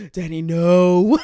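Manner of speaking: slow, loud, panting refusal; out of breath. Retold or imitated. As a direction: gasp it out winded.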